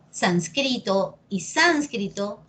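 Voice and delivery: sansCRIto, then SÁNScrito, slowly; first sánscrito on CRI, second on SÁNS; slow demonstration of both stresses of sánscrito; two accepted stresses. Shown as a demonstration. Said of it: "depende de ti cómo lo quieras decir".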